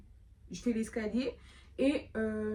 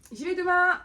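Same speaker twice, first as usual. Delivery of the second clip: loud mock resolve; 10 semitones higher, 6 dB louder than usual.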